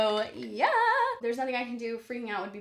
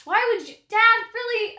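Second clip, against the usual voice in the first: loud, high cry of disbelief; exasperated outcry; 12 semitones higher, 12 dB louder than usual. Real, not acted. Daughter exasperated at dad's crash movie, loud high outcry. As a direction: yell it at dad, fed up.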